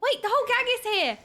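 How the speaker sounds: high, excited exclamation; delighted surprise. Real, not acted. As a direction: gasp and burst out in delight. Suddenly sees all the characters.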